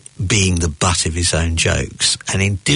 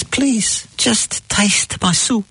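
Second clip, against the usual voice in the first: put-on very high, slow pleading old man; hammed-up insistent elderly diner; slower than usual. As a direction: beg slowly in a high old man's voice. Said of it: "to keep the old man quiet".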